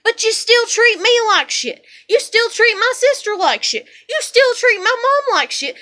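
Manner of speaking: furious rant. Real, not acted.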